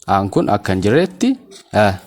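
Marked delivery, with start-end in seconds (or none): none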